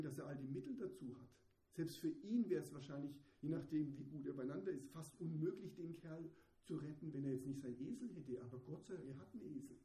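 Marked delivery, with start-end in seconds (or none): none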